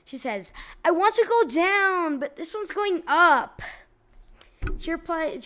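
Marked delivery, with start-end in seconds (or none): 0.8-3.6 lightly voiced whiny woman; a hint of a whiny complaint. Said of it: "she does that in a whiny voice".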